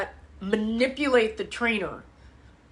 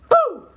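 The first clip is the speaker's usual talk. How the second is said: mock boo; 14 semitones higher, 7 dB louder than usual. Mimicking hormones hitting the body.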